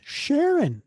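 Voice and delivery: high-pitched urgent yell. Acted. Cap yells at Sharon across the office, urgent yelling. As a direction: call out high and urgent, in alarm. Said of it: "And he yells".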